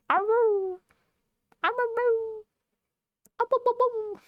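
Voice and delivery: very high howled awoos; wolf howl. Pretend. Howling like a wolf.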